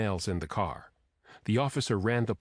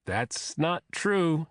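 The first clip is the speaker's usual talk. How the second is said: slow, higher-pitched mild protest; 7 semitones higher, much slower than usual. A narrator's reading. Teased young man to his girlfriend, protesting. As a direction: drag out the denial. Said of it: "Damon protested".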